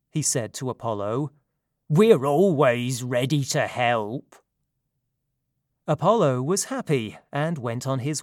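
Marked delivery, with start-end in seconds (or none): 1.9-4.3 hearty satyr voice. Acted. Satyr leader offers help.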